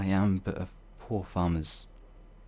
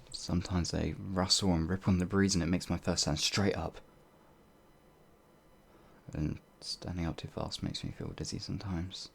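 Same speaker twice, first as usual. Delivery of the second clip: ordinary delivery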